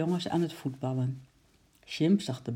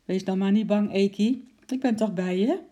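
reassuring comfort; 5 semitones higher, 5 dB louder than usual. A narrator's reading. Sjimp comforts the trembling squirrel.

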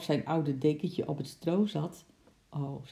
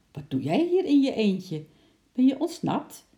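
higher, louder questions rising at the end; surprised questioning; 9 semitones higher, 6 dB louder than usual. A narrator's reading. Bart to the ape found all alone, surprised. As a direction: quiz a little runaway in surprise.

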